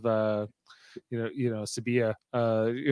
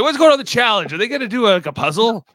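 eager mock voice; 7 semitones higher, 7 dB louder than usual. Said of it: "he's like".

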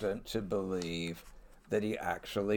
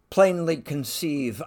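a sudden loud blurt of "Plainly conceive"; 7 semitones higher, 9 dB louder than usual. Retold or imitated. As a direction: let "Plainly" burst out loud and fast, as if by accident. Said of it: "He blurts out".

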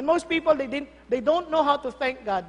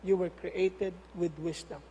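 tender; 8 semitones lower, 9 dB quieter than usual. Shown as a demonstration. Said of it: "Sabi sa katabi mo".

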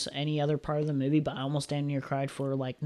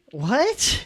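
shocked what; 8 semitones higher, 5 dB louder than usual. Retold or imitated. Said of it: "I was like".

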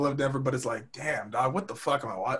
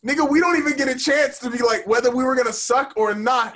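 higher, fast, fed-up comeback; exasperated retort; 9 semitones higher, 4 dB louder than usual. Retold or imitated. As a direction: snap back at the haters, exasperated. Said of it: "I'm like".

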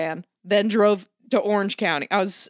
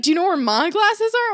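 high, louder mock mom asking; 10 semitones higher, 5 dB louder than usual. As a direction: ask it in a high, clueless mom voice. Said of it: "she's like".

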